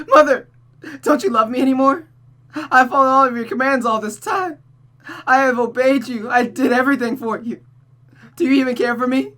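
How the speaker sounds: high, loud crying plea to a silent mother; tearful daughter Rosa. Acted. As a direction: beg through tears, rising to near-hysteria.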